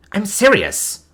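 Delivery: flustered and insistent. Acted. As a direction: blush as you insist. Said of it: "as her cheeks darkened".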